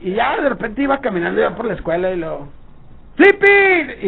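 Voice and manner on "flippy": calling out a name, high and loud; shouted name. Retold or imitated. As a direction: yell the nickname across campus. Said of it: "y me gritaba".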